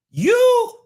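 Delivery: high loud frustrated cry; exasperated outburst. Real, not acted. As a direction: blurt it out in disbelief.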